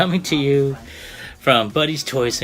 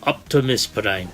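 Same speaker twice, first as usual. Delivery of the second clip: hammed-up mock Japanese announcer accent; bad Japanese accent; slower than usual. Toy reviewer reading the box's big bold letters, bad Japanese accent. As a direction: read it out in a badly faked Japanese accent. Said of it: "that's my bad Japanese accent".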